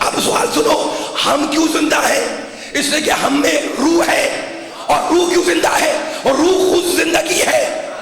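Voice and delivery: preaching at full force, building fervor; impassioned pulpit delivery, mounting to a peak; fervent preaching. Real, not acted. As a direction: drive the point home to the crowd. Preacher rises to fervent climax.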